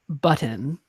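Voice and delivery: "button" with a soft glottal middle; his own "button". Shown as a demonstration. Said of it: "the way that I say".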